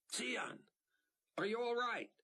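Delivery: high urgent call down a shaft. A narrator's reading. Buck calling down to Sion trapped below, urgent. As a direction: call out anxiously into the vent.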